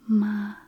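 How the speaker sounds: "Ma" sustained on a level pitch; first tone. Shown as a demonstration. Teacher repeating Mandarin first tone, level "Ma". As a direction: hold "Ma" evenly, no glide. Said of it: "ma with the first tone".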